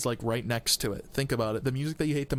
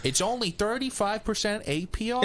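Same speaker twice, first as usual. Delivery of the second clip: slick used car salesman; 7 semitones higher than usual. Retold or imitated. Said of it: "He sounds like a used car salesman".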